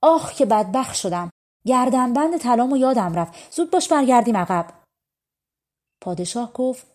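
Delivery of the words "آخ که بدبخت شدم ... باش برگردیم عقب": raised, higher panicked outcry; frantic cry. A narrator's reading. Bride realizing she forgot her necklace, crying out loud. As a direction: cry out in sudden dismay. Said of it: "یهو فریاد زد".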